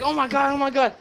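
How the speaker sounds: high, hushed gasping oh my God; a girlfriend's high, soft shock; panicked girlfriend. Retold or imitated. Girlfriend finding him near death, high and hushed in shock.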